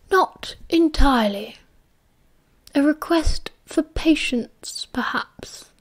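put-on slow old headmaster fading to a whisper; lightly voiced old wizard, dropping to a hush; Dumbledore's voice.

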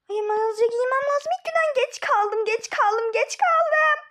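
high, loud, rushed panic; building frantic alarm. Acted. Panicked late for school.

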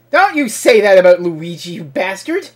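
mock outrage. Mock outrage defending Luigi.

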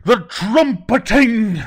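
high, slow terrified scream. Acted. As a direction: scream it and flee. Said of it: "he screamed and ran off".

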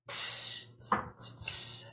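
soft unvoiced tss tss tss like a feathered cymbal; cymbal hiss. Shown as a demonstration. Poet imitating a drummer's cymbal for the crowd, soft hiss. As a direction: whisper the hi-hat rhythm. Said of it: "Your turn".

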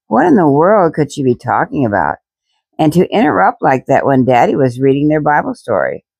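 plain reading voice

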